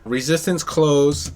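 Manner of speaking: ordinary talk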